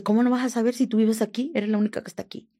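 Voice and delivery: mock angry grilling; angry interrogation. Acted captor interrogating her angrily.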